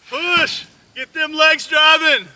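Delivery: loud high shout, slow and driving; hoarse marathon cheering. Real, not acted. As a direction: roar them on to the finish. Cheering someone driving a post.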